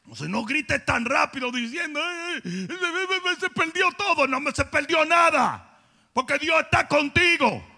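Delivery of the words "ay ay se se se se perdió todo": a whiny, half-sobbed lament; a hint of fake crying, higher; mock wailing. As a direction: ham up the despair of losing everything. Mocking a panicked believer.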